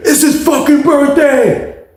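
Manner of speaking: high, drawn-out hyped proclamation; snapping out. Retold or imitated. Cellmate telling everyone about the birthday, wild stretched-out mock yelling. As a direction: announce it slowly, high and frenzied. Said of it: "He's snapping out".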